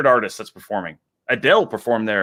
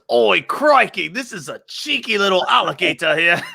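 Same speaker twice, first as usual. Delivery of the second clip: high-pitched Australian accent, mock excitement; Steve Irwin; 6 semitones higher than usual. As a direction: put on a hammy Aussie croc hunter. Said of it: "He goes".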